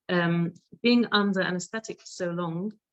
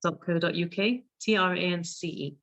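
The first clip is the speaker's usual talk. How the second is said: ordinary talk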